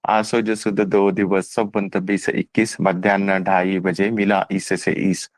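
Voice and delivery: slow, low recital of a devotional couplet; recited verse. Retold or imitated. Recites verse from Asaram path.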